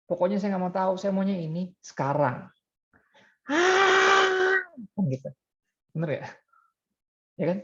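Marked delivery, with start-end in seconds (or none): 0.1-4.6 put-on spoiled child tantrum. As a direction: imitate a toddler who wants it now, with a big high whine. Child demanding its own way.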